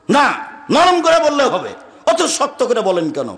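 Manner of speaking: imitating a high, shouted objection; mock shout, falling off towards the end; retold shouting. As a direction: act out the loud complainer, pitch jumping up. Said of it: "আবার খুব চিৎকার করে বলছে".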